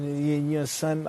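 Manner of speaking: New York slang. Retold or imitated. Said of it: "The way y'all be slinging y'all slang".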